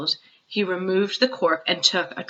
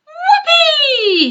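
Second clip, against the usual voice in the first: much higher, louder laughing whoop; whooping cry; 7 dB louder than usual. A narrator's reading. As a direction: shoot up with joy like a rocket. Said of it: "he cried".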